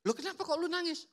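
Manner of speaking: high, worried question; concerned friend. Acted. Friend asking sensitive person why they cry, high and concerned.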